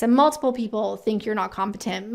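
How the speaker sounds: ordinary talk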